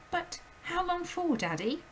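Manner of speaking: put-on high, urgent little girl's voice; a startled child calling out; high girl's cry; Matilda crying out. A narrator's reading. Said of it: "Matilda cried".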